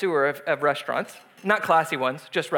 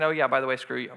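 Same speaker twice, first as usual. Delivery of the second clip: mock snide dismissal; 6 semitones lower, faster than usual. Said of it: "the attitude that I get".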